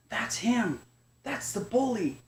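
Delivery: high, fearful cub cry; frightened cub. Acted. Chester points at bully.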